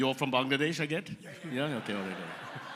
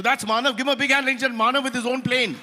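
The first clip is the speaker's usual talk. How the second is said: raised, high-pitched call for applause; rally the room for a big hand; announcer's introduction; 7 semitones higher, 4 dB louder, faster than usual. Real, not acted. Host asking the crowd to applaud Manav, announcer's introduction.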